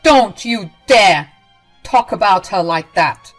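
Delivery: furious warning. Acted. Leon defends wife.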